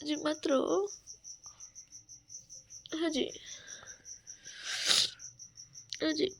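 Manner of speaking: soft, tender comforting; soothing. Real, not acted. Wife quietly soothing her crying husband, tender.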